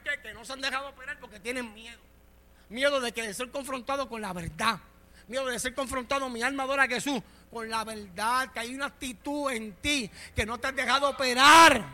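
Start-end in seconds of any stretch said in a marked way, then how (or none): 2.7-11.8 sermon voice building to a loud shout; forceful preaching. Real, not acted. Preacher confronting those afraid of the truth, building to a shout. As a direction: press each phrase harder, peaking at the end.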